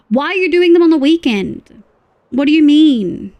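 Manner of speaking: loud, high mock outrage at a viewer; incredulous. Real, not acted. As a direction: demand an answer, baffled. Incredulous at viewer's weekend taxes.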